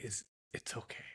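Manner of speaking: imitated intimate whisper; hushed whisper. Masseuse leaning in to reassure the client, whispered. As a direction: lean in and whisper it softly.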